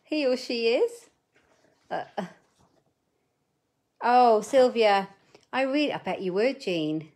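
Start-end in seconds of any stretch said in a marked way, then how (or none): none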